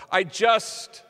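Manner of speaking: a sudden high, loud burst; blurting out. Retold or imitated. Nervous Mario blurting at the microphone, high and loud. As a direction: let two words burst out before you stop. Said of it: "suddenly he blurts out".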